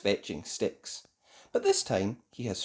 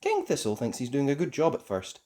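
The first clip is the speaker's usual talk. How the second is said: ordinary delivery, nothing marked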